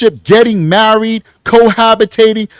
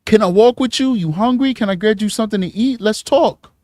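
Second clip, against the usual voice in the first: smooth Harlem street voice, softer; 3 semitones higher, 6 dB quieter than usual. Retold or imitated. A predator coaxing a lone girl, soft Harlem voice. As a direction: lure her in gently like a slick hustler. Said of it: "Let me put the Harlem voice on".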